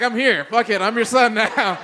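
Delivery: mock defiant claim. Declaring himself adopted son.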